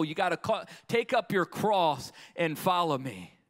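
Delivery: loud, driving sermon delivery; full-force preaching. Real, not acted. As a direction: press the call on the congregation. Preaches the call to follow.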